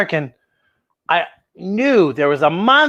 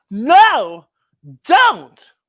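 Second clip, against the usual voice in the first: much higher, loud frightened no don't; scared cry; 5 semitones higher, 6 dB louder than usual. Acted. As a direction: cry out in fright to stop her. Friends scared of closet.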